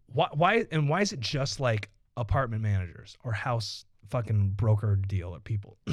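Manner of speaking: ordinary talk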